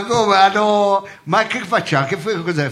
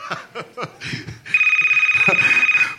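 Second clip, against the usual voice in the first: squealing giggle; a shrill burst of laughter; high laugh. Acted. High laugh before phone call. (risata acuta)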